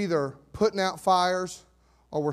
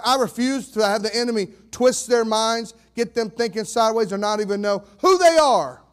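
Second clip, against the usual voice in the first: loud, impassioned preaching; raised, forceful preacher's defiance; fired up; 4 semitones higher, 6 dB louder than usual. Real, not acted. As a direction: punch it out, building to a shout. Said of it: "you're gonna hear me get fired up".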